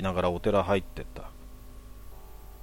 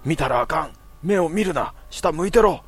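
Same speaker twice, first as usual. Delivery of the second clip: high, loud, urgent yells that climb higher; retold frantic shouting; 8 semitones higher, 7 dB louder than usual. Mother yells at her son not to look at the woman. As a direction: bark the warnings higher and harder each time. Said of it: "って叫んだ".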